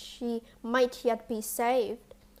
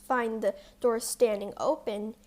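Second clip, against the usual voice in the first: usual speaking voice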